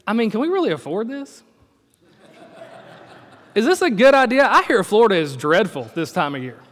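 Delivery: doubtful, prissy reply; a kid doubting the Disney trip gift, mock skeptical. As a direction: sound unimpressed and worried.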